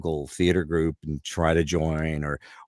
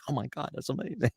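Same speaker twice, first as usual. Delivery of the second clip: high, soft retold amazement; amazed reaction; 8 semitones higher, 8 dB quieter than usual. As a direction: react in hushed mock astonishment. Shatner amazed by the impression.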